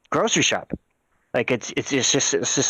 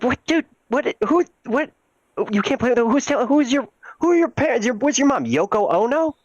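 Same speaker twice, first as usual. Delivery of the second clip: shocked outburst; 11 semitones higher than usual. Retold or imitated. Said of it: "And I was like".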